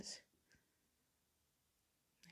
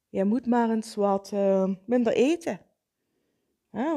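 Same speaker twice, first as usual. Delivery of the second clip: nagging inner critic; 4 dB louder than usual. Retold or imitated.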